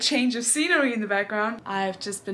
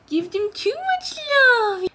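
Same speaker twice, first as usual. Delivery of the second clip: high, slow gushing coo; 13 semitones higher, 6 dB louder, slower than usual. Real, not acted. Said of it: "I also talk in a baby voice like this".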